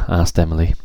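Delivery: ordinary delivery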